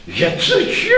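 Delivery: high, shrill-loud monster hiss; hissing octopus. A narrator's reading. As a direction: call him like a hissing sea beast. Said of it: "syczała ośmiornica".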